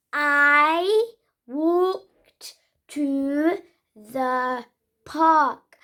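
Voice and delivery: slow, careful "I walked to the park"; stretched-out teaching read; slow model sentence. Shown as a demonstration. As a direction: drill the sentence word by word. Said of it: "help you learn the letter I".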